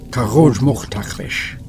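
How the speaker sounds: ordinary delivery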